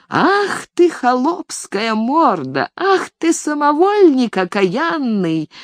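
put-on high, drawn-out old crone yell; old woman yelling. A narrator's reading. Nanny yelling after the fleeing servant, high and slow. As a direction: shriek after him like a scandalized nanny. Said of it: "кричала ему вслед".

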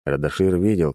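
usual speaking voice

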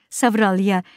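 usual speaking voice

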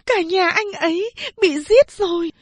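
high, tearful pleading voice; begging mother. A narrator's reading. Kneeling daughter begs mother to spare the child, tearful. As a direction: beg her for mercy, near tears. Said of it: "con van mẹ".

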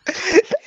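high shrieking laugh. Real, not acted. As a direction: burst out in a shrill laugh. Laughing shout at the joke.

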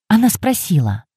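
ordinary delivery

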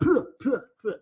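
short, high mock sputters; imitated "pyr" noises. Podcaster mimicking a film character's noises, high mock sputtering. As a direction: puff out high little "pyr" sounds in imitation. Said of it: "siger han".

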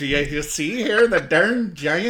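loud, slow mock Michigan accent; hammed-up Upper Midwest accent. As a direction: stretch it out in a folksy Michigan twang. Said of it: "like, from Michigan".

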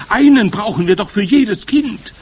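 high, loud crowd outcry; dismayed cry. A narrator's reading. Witch audience objecting to the plan, crying out. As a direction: cry out in protest. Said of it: "schrien die Zuhörerinnen".

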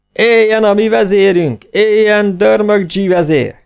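high, loud cheer, slowing on the second; cheering crowd. A narrator's reading. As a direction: cheer him as your chief. Crowd cheers new leader.

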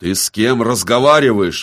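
loud, outraged challenge; soldier's indignant outburst; angry roar. A narrator's reading. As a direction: roar it at the upstart. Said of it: "взревел здоровяк".

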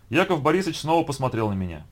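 plain reading voice

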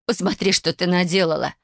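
ordinary delivery, nothing marked

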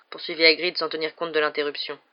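plain reading voice

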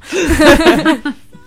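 high loud real laughter. Real, not acted. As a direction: let the laugh burst out. Laughing at own joke.

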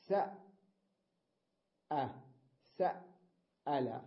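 سأل broken apart, sa with a catch, long pause, then al; separated double hamza. Shown as a demonstration. Teacher illustrating the second rule, slowly split. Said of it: "همزة الأولى ساكنة والثانية متحركة".

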